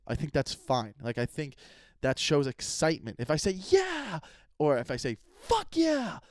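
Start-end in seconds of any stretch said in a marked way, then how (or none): 3.5-4.2 much higher single yeah; a bright demo cheer; excited yeah. Shown as a demonstration. Demo for listeners, high excited yeah. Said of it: "fuck yeah sounds way more exciting". 5.4-6.2 much higher, punchy cheer; excited cheer. Shown as a demonstration. Demo for listeners, high punchy cheer. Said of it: "fuck yeah sounds way more exciting".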